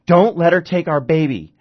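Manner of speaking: high, loud desperate cry; frantic plea. Acted. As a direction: beg your husband in a panic. Mother yanks husband close.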